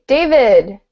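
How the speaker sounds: ordinary talk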